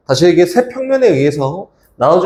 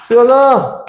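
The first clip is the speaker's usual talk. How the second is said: calling out; 9 semitones higher, slower than usual. Real, not acted. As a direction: call across the room for Suyeon. Calling out a name mid-drawing.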